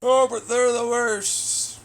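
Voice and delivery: high slow mocking whine; put-on whine. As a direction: stretch it out like a petulant cartoon.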